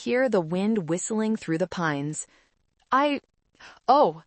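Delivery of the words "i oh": halting, higher-pitched fluster; flustered, broken-off start; stammering. A narrator's reading. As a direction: falter with pauses, caught out. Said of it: "Jessica stammered".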